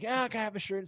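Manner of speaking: lightly mimicked child, pitched high; imitated kid asking. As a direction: pipe up like a kid asking a favor. Voices kids asking for shirts.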